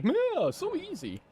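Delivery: high, slow, smug and casual; mock breezy. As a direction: brush it off like it's nothing. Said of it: "I'm just like".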